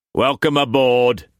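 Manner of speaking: rough voice. A narrator's reading. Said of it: "his voice rough".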